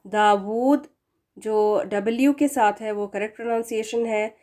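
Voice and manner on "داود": داود with a rounded w and long u; correct pronunciation. Shown as a demonstration. Teacher models correct داود. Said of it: "اس کا pronunciation دیکھیں ادھر".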